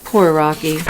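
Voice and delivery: plain reading voice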